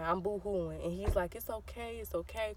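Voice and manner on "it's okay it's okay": very soft, imitated brotherly reassurance; comforting. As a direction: soothe softly, like a consoling brother. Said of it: "so he's comforting me".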